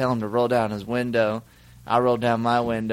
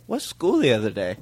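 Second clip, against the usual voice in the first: feigned confusion; 2 semitones lower than usual. Retold or imitated. Friends teasing Clay about his remark, feigned confusion. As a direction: ask as if "school the other day" were one word. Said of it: "we act like it's one word".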